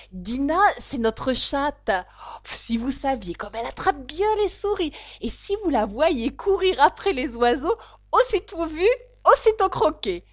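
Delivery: high, loud, mounting enthusiasm; eager. A narrator's reading. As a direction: gush proudly, climbing to a delighted peak. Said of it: "répondit avec empressement". (empressement enthousiaste, voix aiguë et forte)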